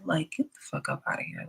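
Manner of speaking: voiceless whisper under her breath; whispered aside. Real, not acted. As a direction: drop to a bare whisper, half to herself. Muttered aside to herself.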